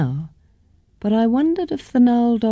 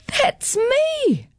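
high, astonished cry; excited shout. A narrator's reading. Caitlin sees her name in daffodils, high excited cry. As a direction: burst out in disbelief. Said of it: "I shouted".

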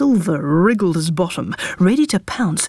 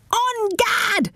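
high brave cry; battle cry. A narrator's reading. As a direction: cry out charging.

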